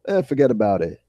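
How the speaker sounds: dismissive New York shrug; lightly hammed New York accent. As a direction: put on a casual New York brush-off. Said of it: "You sound so New York".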